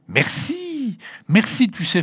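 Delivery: put-on very high, delighted little-girl thanks; consoled, delighted thanks. A narrator's reading. Girl thanks the blonde child for an egg, delighted. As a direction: thank her, wonderfully consoled. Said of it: "déjà merveilleusement consolée".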